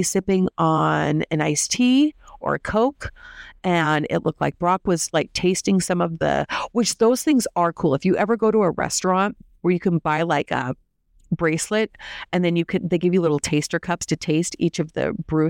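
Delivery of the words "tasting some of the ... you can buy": usual speaking voice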